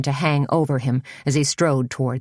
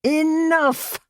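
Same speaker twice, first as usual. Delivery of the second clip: very high shriek of rage; shrill shout; 13 semitones higher than usual. A narrator's reading. The enraged leader shrieks at Suzanne, shrill. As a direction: shriek it, spitting with fury. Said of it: "shrilled Elam".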